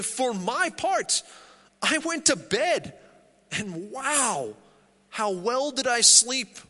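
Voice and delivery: amazed at how well he slept; David's delighted voice. Retold or imitated. Preacher voicing David waking, marvelling in delight. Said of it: "It's as if David was saying".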